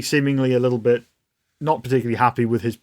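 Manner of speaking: usual speaking voice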